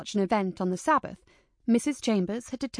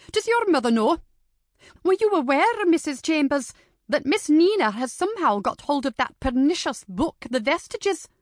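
higher and louder, righteously outraged; indignant scolding; 6 semitones higher, 5 dB louder than usual. Acted. Lady Janet rebukes Missus Chambers over the book, indignant and loud. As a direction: scold like a shocked dowager.

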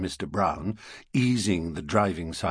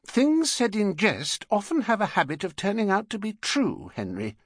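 much higher warning woman's voice; warning wife; 12 semitones higher than usual. Acted. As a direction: caution him like a wary wife. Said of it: "warned Missus Brown".

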